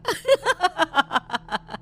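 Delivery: high evil cackle; evil witch cackle. Acted. Witch laughs after transforming.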